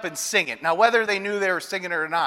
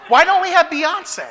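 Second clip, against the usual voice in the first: indignant daughter; 7 semitones higher than usual. Retold or imitated. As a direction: demand it like a put-out little girl. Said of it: "She goes".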